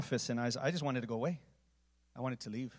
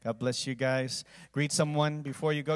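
ordinary talk

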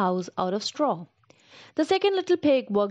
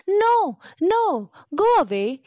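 high, squeaky little pigs crying no; frightened piglets shooing the wolf away; scared little pigs; 7 semitones higher, 4 dB louder, slower than usual. A narrator's reading. Little pigs to the wolf at the door: high, frightened no.

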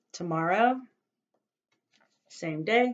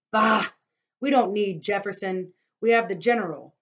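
loud, higher scoffing bark; scoffing outburst; 4 semitones higher, 9 dB louder than usual. Acted. Mister Karras dismissing Jefferson to Grandfather, loud scoff. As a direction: brush Jefferson off loudly.

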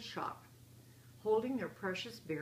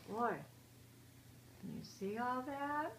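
plain reading voice

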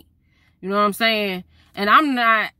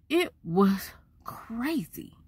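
whisper; 3 semitones higher, 6 dB quieter, slower than usual. Real, not acted. Hushed aside about the drive.

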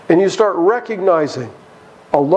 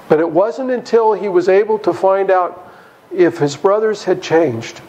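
ordinary talk, nothing marked

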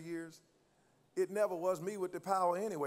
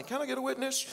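preacher's call; 7 semitones higher, faster than usual. Acted. Preacher calling for response.

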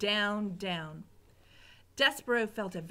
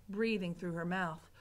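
plain reading voice

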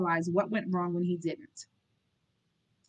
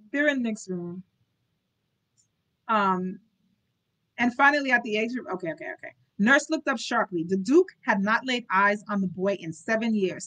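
ordinary delivery, nothing marked

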